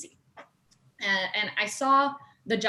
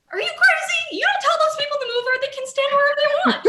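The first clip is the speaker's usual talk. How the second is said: high, loud mock shriek; hammed-up incredulous yell breaking into laughter; outraged scolding; 9 dB louder than usual. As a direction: act out teammates yelling in disbelief, fast and high. Team scolding her, acted out.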